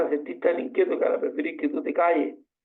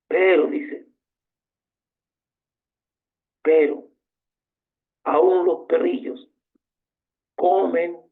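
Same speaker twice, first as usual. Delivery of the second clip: louder, insistent pleading of the woman; preacher voicing the woman's bold, louder reply to Jesus; emphatic plea; 5 dB louder, slower than usual. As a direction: push back boldly but humbly.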